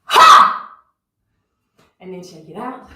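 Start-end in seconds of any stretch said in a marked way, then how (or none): 0.0-0.7 forceful exhale. Real, not acted. Breathwork exhale after held breath.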